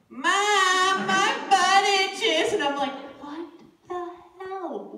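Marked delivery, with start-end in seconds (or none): 0.1-2.5 put-on high, loud, drawn-out kid's wail; whining kid. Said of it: "Tegan's waking up going". 3.2-5.0 slow, hushed, higher-pitched bafflement; baffled reaction. Retold or imitated. Baffled reaction to son's complaint.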